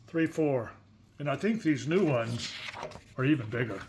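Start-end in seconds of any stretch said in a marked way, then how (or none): none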